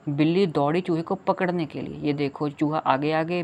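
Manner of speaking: normal talking voice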